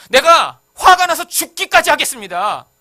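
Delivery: loud, high and slow furious vow; angry outburst. Retold or imitated. Pastor voicing his anger at God like Jonah, loud furious vow. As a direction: hurl it at God in rage. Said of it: "하나님한테 그렇게 말하고 싶은 거예요".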